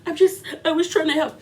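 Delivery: hammed-up weepy protest; mock crying. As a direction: imitate a fake tearful victim, mockingly. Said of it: "Diana wanna start crying".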